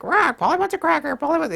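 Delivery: high squawking parrot voice. Retold or imitated. Mocking debaters who just repeat themselves, high parrot squawk. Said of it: "they'll keep saying the same thing over and over again".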